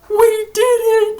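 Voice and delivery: high, slow triumph; excited. Retold or imitated. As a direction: cheer the victory. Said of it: "They were probably thinking".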